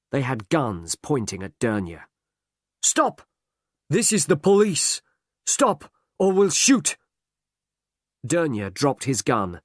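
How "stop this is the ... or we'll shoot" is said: loud, high commanding shouts; police shout. A narrator's reading. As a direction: order them to freeze at gunpoint. Police ambushing armed criminals.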